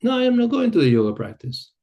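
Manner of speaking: lightly voiced insistent man; mock insistent man. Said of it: "the guy look at me and say".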